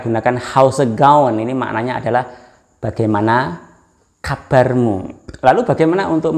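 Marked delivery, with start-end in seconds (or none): none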